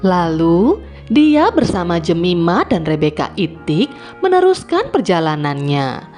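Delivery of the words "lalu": usual speaking voice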